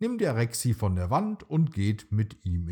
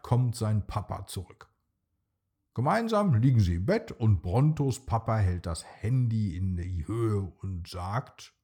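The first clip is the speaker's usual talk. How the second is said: ordinary delivery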